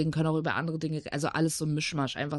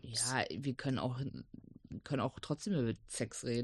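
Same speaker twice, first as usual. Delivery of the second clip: hushed; 7 semitones lower, 9 dB quieter than usual. Real, not acted. Hushed talk about sex.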